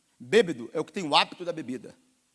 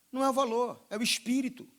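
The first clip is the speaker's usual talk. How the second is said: usual speaking voice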